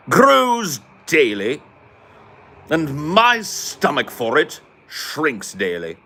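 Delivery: slow, high, hammed-up British indignation; pompous mock British, every word stretched out; salty Brit. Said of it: "salty Brits who were".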